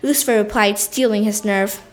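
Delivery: usual speaking voice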